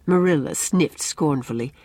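usual speaking voice

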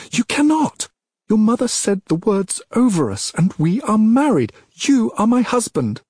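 high, loud pleading outcry; desperate shout. Acted. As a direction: cry it out in desperation. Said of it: "voice rising again".

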